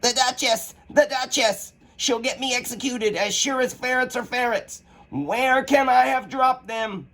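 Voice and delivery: high, fretful rabbit voice. Acted. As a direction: panic over the lost gloves. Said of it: "muttering to itself".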